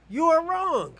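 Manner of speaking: YOU ARE WRONG shouted high and loud; emphatic shout. Real, not acted. Shouting at his debate opponent that he is wrong, high and loud. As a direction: hammer the point home with a yell. Heated argument climax.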